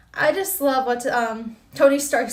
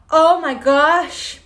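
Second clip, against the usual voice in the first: slow, louder mock shock; retold shocked gasp; 5 dB louder, much slower than usual. Retelling her reaction to Quicksilver, slow, loud amazement. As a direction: stretch it out like you just saw him. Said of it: "I literally hollered when I saw him".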